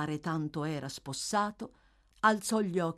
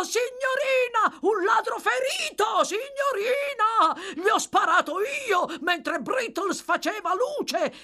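excited bellowing; 6 dB louder than usual. A narrator's reading. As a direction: yell the big news up the stairs. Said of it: "sbraitò a gran voce, eccitatissimo, voltandosi verso la scala".

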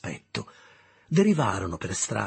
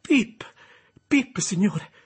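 high, loud, scared repeat of the name; frightened shout; 10 semitones higher, 6 dB louder, much slower than usual. Acted. Pip obeying the convict's order to speak up: frightened shout. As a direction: shout it out because he was told to.

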